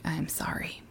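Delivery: whispered, barely voiced apology; humble whisper. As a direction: breathe the words out, humbled. Job repents to God.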